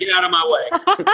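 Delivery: high, laughing brash American; American accent. Pretend. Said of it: "I'm American".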